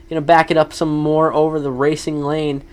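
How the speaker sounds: ordinary talk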